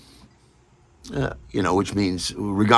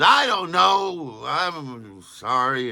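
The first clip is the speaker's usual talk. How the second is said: mumbled apology; 7 semitones higher, much slower than usual. Retold or imitated. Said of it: "he sort of mumbled and grumbled".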